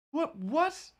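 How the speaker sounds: a squeaked double take; high, incredulous what-what; baffled what. Real, not acted. Disbelief at the plot.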